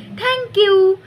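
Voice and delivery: high, loud delighted thank-you; happy thanks. A narrator's reading. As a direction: squeal your thanks. Sister thanks happily.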